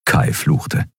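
usual speaking voice

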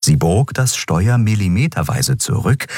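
plain reading voice

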